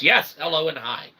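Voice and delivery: ordinary delivery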